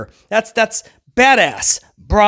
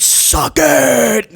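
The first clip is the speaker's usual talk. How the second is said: mock shout; 3 semitones higher than usual. Quoting the DX shirt.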